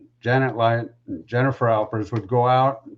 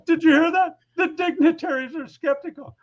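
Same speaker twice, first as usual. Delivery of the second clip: high mocking voice, still laughing. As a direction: imitate the boss sharing the joke, much higher. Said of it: "falling all over himself laughing".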